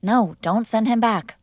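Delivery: ordinary talk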